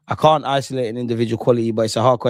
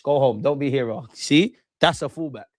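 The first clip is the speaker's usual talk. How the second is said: ordinary talk, nothing marked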